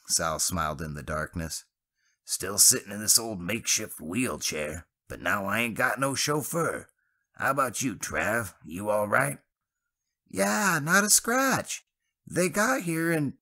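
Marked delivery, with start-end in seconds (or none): 2.2-9.4 lightly voiced easygoing Sal, a little higher; relaxed buddy talk, lightly voiced; a hint of Sal. A narrator's reading. 10.3-13.4 high, louder voice, slow on not a scratch; cheerful young Travis, cut off mid-word; Travis's voice. A narrator's reading.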